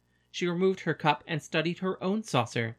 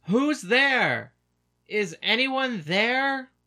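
a man's distant, high, drawn-out call down the stairs; calling down from balcony; 7 semitones higher, 5 dB louder, much slower than usual. Acted. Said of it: "calling down".

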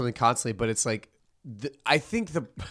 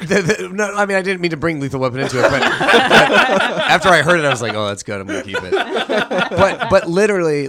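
laughing; 5 semitones higher, 7 dB louder than usual. Real, not acted. Laughing at own pun.

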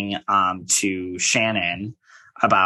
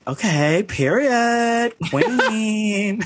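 high-pitched, drawn-out cheer of approval; hyping coworker; 11 semitones higher, much slower than usual. Retold or imitated. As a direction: mimic a gushing colleague, pitching it way up. Imitating a hyping coworker's catchphrase.

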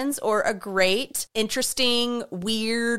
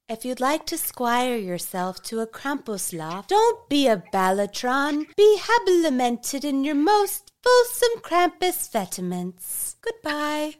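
put-on pompous old-fashioned announcer voice, high on "Be habilimented"; hammed-up archaic proclamation; mock grandiose sign-off; 5 semitones higher than usual. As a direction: ham up the fancy words like a stuffy herald. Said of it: "mock-archaic sign-off".

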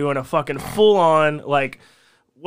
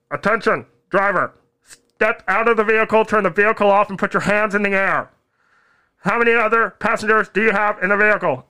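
higher, loud police order over a loudspeaker; police command; 6 semitones higher, 4 dB louder than usual. Retold or imitated. Cop orders the driver out of the car, a loud mock command. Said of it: "They said".

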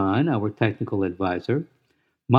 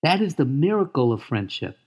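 put-on high, eager young pig voice; excited gentle pig, proud and tender; Wilbur voice; 4 semitones higher than usual. Wilbur greets Charlotte's daughters.

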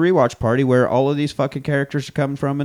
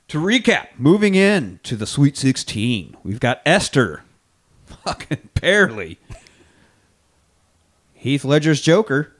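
slow, spaced-out announcer delivery; announcer recap; 4 semitones higher, slower than usual. Acted. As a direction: announce each finalist with drama.

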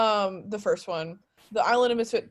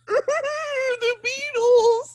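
excited tourist shout; 6 dB louder, much slower than usual. Retold or imitated. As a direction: squeal it like a starstruck tourist. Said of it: "tourists being like".

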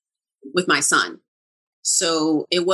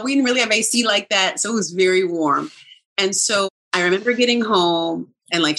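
usual speaking voice

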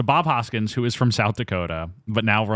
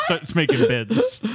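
slow, higher, through laughter; laughing; 5 semitones higher, slower than usual. Real, not acted. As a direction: push the phrase out while laughing. Cracks up at own innuendo.

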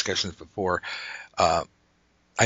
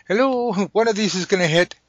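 mock cry; 11 semitones higher, 4 dB louder than usual. Mock archer firing from fortress.

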